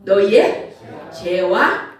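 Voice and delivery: very slow, weighty delivery; slow scripture quote. Acted. Preacher reading judgment to the church, very slow. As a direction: lay each word down slowly.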